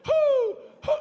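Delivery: high, loud hooting together; owl hoot. Retold or imitated. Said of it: "we'll all hoot".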